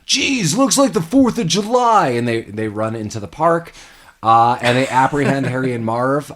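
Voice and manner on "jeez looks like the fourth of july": high-pitched, put-on marvelling; mock amazement. Cops arriving at fireworks, imitated high amazement. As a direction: imitate a cop wowed by fireworks.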